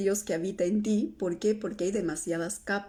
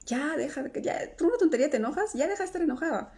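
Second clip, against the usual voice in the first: higher, fast, dismissive mock scolding; telling off an angry girl, higher and quick; scolding parent imitated; 6 semitones higher, faster than usual. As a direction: rattle it off like an impatient mother brushing off a child's anger. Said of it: "o te decían".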